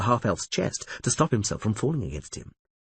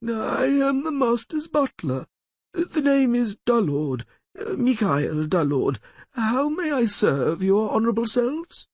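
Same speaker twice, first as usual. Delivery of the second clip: higher, squeaky dim-witted servant; squeaky half-wit voice; 14 semitones higher than usual. Acted. The lawyer fakes being a dim butler to strangers, squeaky. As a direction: play the fool in a thin squeak. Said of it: "speaking with a slightly squeaky voice".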